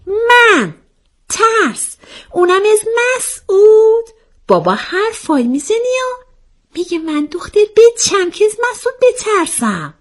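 very high, incredulous squeal of denial; indignant disbelief. A narrator's reading. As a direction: scoff at the very idea in a high voice. Indignant denial of being afraid. (با تعجب و اعتراض، صدای زیر)

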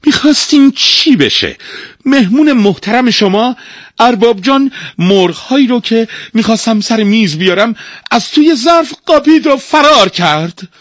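very high, loud outraged tattling; indignant servant. Acted. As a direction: report the theft in a shrill outburst. Servant complains of the thieving guest.

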